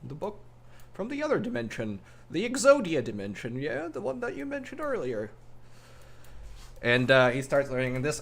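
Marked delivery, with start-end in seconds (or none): 0.0-5.3 high, excited mumbling to oneself; muttering sage. Acted. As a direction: mutter eagerly, voice climbing high. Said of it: "starts muttering".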